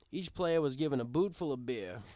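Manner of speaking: plain reading voice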